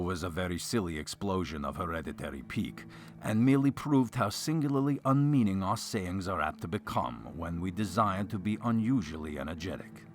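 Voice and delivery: ordinary delivery, nothing marked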